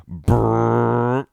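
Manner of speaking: loud, high car-zoom sound; mimicked revving brrr; imitated engine noise. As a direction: buzz the lips like a car speeding off.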